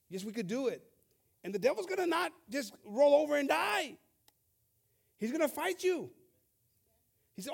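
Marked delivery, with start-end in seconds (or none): 1.4-6.1 rising preacher emphasis. Acted.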